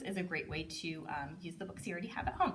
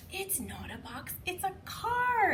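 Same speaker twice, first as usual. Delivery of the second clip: reveal excitement; 11 semitones higher, slower than usual. Shown as a demonstration. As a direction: build to a delighted reveal. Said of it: "It says".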